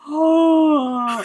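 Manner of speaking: big, loud, high gasped "oh"; hammed-up horrified intake; shocked gasp. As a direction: gasp in exaggerated outrage. Gasps at being smiled at.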